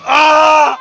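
real, very loud open yell; loud scream. Real, not acted. Man being subdued by police, loud scream. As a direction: scream out mid-struggle. Struggle during arrest.

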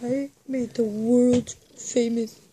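slow, dragged-out character voice; Kevin Malone. Acted. Friend competing at a chili-bragging impression, slow character voice. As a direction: drag every word out like a slow office oaf. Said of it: "Kevin Malone".

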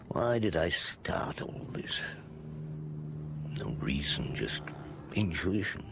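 slow whisper to himself; tired whisper of self-doubt; whispered brooding. Acted. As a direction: hush it, half-thinking aloud. Inspector brooding alone at night.

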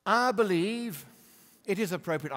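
Al Gore impression. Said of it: "I can't do his dreadful accent".

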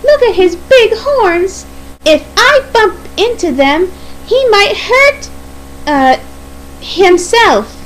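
high-pitched, faltering nervous excuse. Acted. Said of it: "said Daisy nervously".

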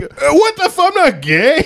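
high, strained mock panic; panicked denial. The man denying he's into the male voice, high panicked squeal. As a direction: squeal it out defensively. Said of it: "He's like".

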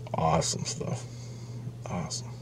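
soft whispered wrap-up; hushed, satisfied aside. Real, not acted. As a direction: whisper it to yourself. Closing aside after story.

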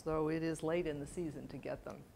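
usual speaking voice

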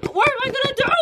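high frantic wail; frantic cry. Acted. Bill despairing without toothpaste, high frantic cry. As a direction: cry out helplessly. Repeated meltdown.

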